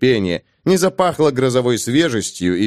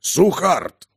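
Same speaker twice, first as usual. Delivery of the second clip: cheerful dwarf; 5 semitones higher than usual. Acted. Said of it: "с улыбкой сообщил".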